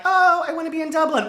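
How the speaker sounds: high, mock-longing sigh; mock wistful. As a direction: swoon over Dublin in a high voice. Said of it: "And I was like".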